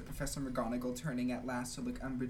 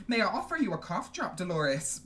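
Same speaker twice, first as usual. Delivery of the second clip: lightly acted curt offer, louder; mildly brusque professor; 5 semitones higher, 6 dB louder than usual. A narrator's reading. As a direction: ask it curtly, a bit raised. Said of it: "Professor McGonagall asked curtly".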